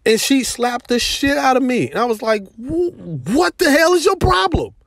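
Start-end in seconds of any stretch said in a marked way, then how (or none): 2.6-4.7 high, indignant demand; angry confrontation. Retold or imitated. To the girl who just slapped him, high outraged demand. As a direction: confront her, outraged, voice shooting up.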